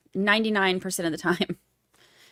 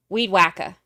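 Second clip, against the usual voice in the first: ordinary talk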